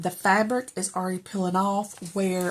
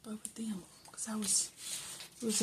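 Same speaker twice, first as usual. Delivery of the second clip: whispering; 2 semitones higher, 13 dB quieter than usual. Real, not acted. Quiet aside while writing.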